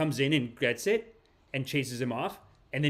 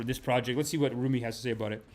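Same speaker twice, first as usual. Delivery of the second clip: ordinary talk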